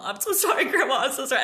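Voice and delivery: high, loud, repeated pleading; frantic apology. Retold or imitated. Child who hurt her grandma, frantically apologizing. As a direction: act out a panicked child apologizing over and over. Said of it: "I just couldn't stop saying".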